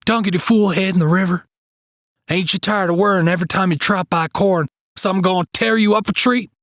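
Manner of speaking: high, fast, urgent pleading; a man half mad with hope, words tumbling out; frantic Joe. Acted. Joe urging Hake to give up the Cratch, frantic high pleading.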